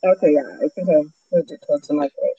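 muffled, watery mic sound; talking through bad underwater audio; hydrophone underwater voice. Real, not acted. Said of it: "It sounds like underwater right now".

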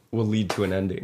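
usual speaking voice

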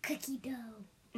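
breathy whispered answer; whispering. Real, not acted. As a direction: whisper it softly.

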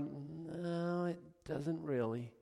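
soft, unbothered reply; calm God. Retold or imitated. God answering anxious believers, soft and unbothered. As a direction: gently brush the worry aside.

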